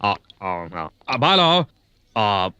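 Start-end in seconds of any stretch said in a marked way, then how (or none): 0.0-0.7 low drawn groan. Acted. Groaning drunkenly.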